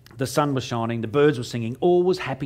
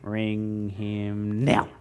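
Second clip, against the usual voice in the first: slow, low, scheming order; conspiring mate; 5 semitones lower, 4 dB quieter, much slower than usual. Retold or imitated. Mate in the car urging the others, slow and low. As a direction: say it like plotting a prank. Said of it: "he's on the phone to them going".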